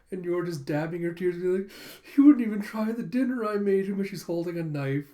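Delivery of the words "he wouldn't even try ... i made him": high, loud hurt complaint; teary menacing wife. Retold or imitated. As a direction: sob it while gripping a knife. Dabbing tears, holding knife.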